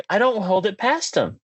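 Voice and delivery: ordinary talk, nothing marked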